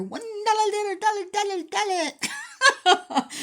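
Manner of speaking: high, loud rapid auction chant breaking into a laugh; hammed-up auctioneer calling bids; mock auctioneer. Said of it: "I feel like I'm an auctioneer".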